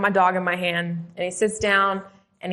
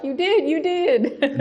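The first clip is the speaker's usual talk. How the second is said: laughing agreement; 8 semitones higher, 3 dB louder, slower than usual. Retold or imitated. Chaplain voiced, laughing.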